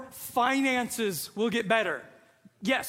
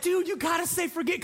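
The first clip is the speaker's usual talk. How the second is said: higher, pleading aside; urgent prompting; 6 semitones higher than usual. Retold or imitated. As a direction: imitate a dad coaching his kid. Dad urgently prompting his son.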